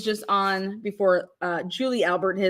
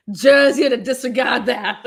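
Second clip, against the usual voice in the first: a laughing, louder hint of the judge ordering the jury; imitating the judge; 3 semitones higher, 5 dB louder than usual. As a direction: tell the jury to disregard it, half laughing.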